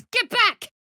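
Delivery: high, loud, sharp warning cry; alarmed shout. Acted. Adventurer startled by a monster, shouting get back. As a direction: yell at the thing to back off.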